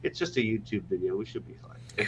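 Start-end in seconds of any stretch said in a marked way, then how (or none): 1.2-1.8 whisper. Real, not acted. Repeats reassurance, then whispers it.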